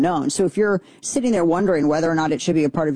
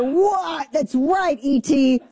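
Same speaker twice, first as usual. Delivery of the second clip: gleeful; 8 semitones higher, much slower than usual. Real, not acted. Gleeful agreement with website.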